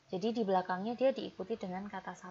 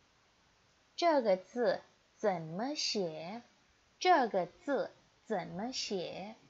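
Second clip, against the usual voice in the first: slow model reading; 4 dB louder, much slower than usual. Shown as a demonstration. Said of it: "teacher models Mandarin sentences".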